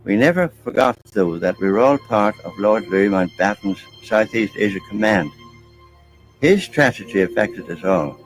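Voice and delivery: ordinary delivery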